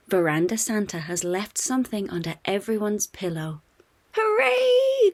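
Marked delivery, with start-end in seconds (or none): 4.1-5.1 high, loud joyful "Hooray!"; kids cheering. A narrator's reading. Kids finding presents under the pillow, cheering. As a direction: cheer with all you've got. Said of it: "cry the kids".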